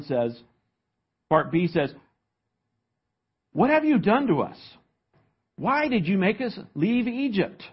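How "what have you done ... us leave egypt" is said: higher-pitched accusing questions; mildly indignant, pitched-up blame; blaming. Acted. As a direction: act out people blaming their leader. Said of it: "They're blaming Moses".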